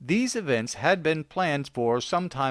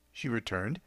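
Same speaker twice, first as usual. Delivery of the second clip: plain reading voice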